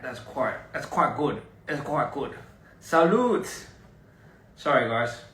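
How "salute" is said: high, loud toasting cheer; toast call. Real, not acted. Toasting viewers with Don Julio, loud high cheer.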